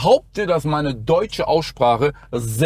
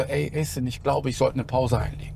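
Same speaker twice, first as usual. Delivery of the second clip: quiet retold quote; 4 semitones lower, 7 dB quieter than usual. Retold talk with a friend.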